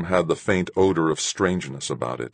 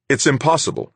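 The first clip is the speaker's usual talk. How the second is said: higher, louder, firm refusal; as firmly as possible; 10 semitones higher, 5 dB louder than usual. A narrator's reading. Owner refuses the captain's daughter, firm loud refusal. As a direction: plant your feet and refuse. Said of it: "as firmly as I could manage".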